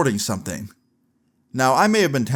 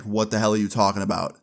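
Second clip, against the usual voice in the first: ordinary delivery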